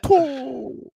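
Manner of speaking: high drawn-out Count voice; the Count saying two. Retold or imitated. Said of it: "the way he says two is real funny".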